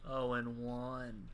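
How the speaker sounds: slow, low doom voice sinking to a hum; mock doom. Announcing a co-host's losing record, mock doom. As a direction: toll it like a funeral bell. Mock dramatic loser sting.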